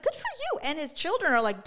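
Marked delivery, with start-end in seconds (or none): 0.0-0.6 high, bright-pitched cheer for him; mock cheer. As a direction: squeal a little cheer of approval. Cheering on widower in show.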